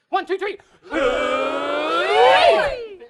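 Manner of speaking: usual speaking voice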